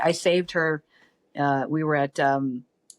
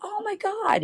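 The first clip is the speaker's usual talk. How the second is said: high squeal of surprise, much higher; shocked recognition; slower than usual. Retold or imitated. Bodyguard recognizing an old friend, high surprised squeal. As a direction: gasp it out in sudden recognition. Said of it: "And he goes".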